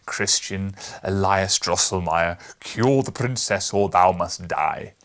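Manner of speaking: loud, slow furious decree; angry royal command. A narrator's reading. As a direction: thunder the order at the watchmaker. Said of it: "he cried".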